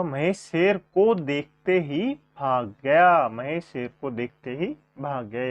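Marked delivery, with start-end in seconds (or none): 0.0-3.3 slow, clear, every word spelled out for the class; slow, deliberate teacher's read; slow dictation. Shown as a demonstration. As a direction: dictate the corrected sentence slowly. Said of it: "Mahesh का शुद्ध वाक्य होगा".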